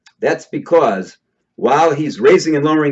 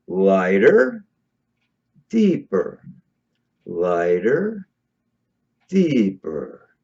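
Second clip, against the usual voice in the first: voice drops on deeper; 8 semitones lower, 3 dB quieter, much slower than usual. Shown as a demonstration. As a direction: voice sinks low and soft on each deeper, lifts on lighter. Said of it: "you hear how my voice is starting to change every time I start to move toward deeper".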